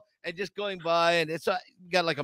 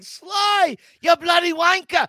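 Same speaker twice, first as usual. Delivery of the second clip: high, loud mock Cockney shouting; yelling fan; 10 semitones higher, 8 dB louder than usual. Teasing her as a fan yelling from a table, mock yell. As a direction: holler across a crowded room, hammed up. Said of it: "standing up on a table yelling".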